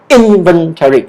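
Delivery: "Inventory", leading hard with "In"; stress on first syllable. Shown as a demonstration. Teacher invites repetition, "Inventory" stressed on "In". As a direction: push the "In", then fall. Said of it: "the first syllable".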